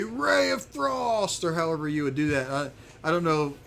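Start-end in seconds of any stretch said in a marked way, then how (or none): 0.0-1.4 high, drawn-out incantation call; spell-casting cry. Pretend. Game master acting out a player's spell, theatrical spell cry. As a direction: cast it with a long, soaring flourish. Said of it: "you run up and you say".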